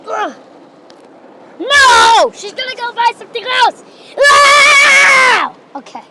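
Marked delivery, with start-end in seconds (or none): 1.6-3.8 high, wailing protest; loud high "no" into a whiny complaint; exasperated outcry. Real, not acted. Exasperated cry while waiting. 4.2-5.5 loud, high, sustained scream; piercing held "ah". Real, not acted. Screams while waiting.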